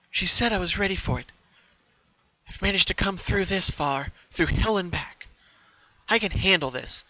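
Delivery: bracing herself against fear; determined Pinky. Acted. Pinky alone, steeling herself to go on, determined.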